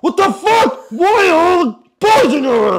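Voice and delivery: high-pitched mock outrage; acted angry phone call. Boyfriend phoning girlfriend about boys in her room, mock outraged. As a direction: demand answers in hammed-up disbelief.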